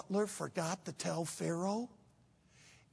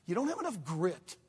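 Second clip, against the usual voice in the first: ordinary talk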